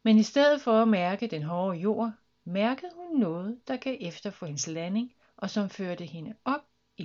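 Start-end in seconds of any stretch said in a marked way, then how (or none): none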